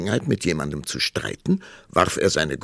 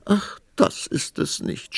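high, slow tearful wail; sobbing, acted. A narrator's reading. Queen explaining her tears to the king, sobbing. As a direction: act out a sob, voice giving way. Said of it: "schluchzte die Königin".

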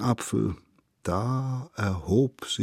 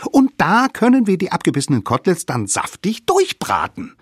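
put-on high, loud child-figure voice, excited; eager littlest savage; 9 semitones higher, 4 dB louder than usual. A narrator's reading. Littlest savage urges friends to bite the mammoth, eager. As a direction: pipe up high and talk them into it.

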